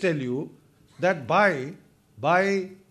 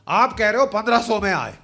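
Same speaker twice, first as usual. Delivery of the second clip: normal talking voice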